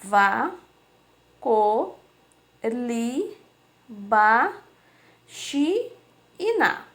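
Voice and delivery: "va, co, li, ba, chi e na" each held alone, pause after each; drawn-out list "va, co, li, ba, chi e na", beat by beat; syllables one at a time. Shown as a demonstration. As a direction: read out "va, co, li, ba, chi e na" as isolated syllables. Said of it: "eu tenho lá dentro do quadro".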